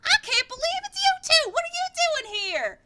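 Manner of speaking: very high, loud squeal of joy; thrilled greeting. Acted. Mina Lee greeting her friends, very high thrilled squeal. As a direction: shriek with delight at old friends. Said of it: "she's like".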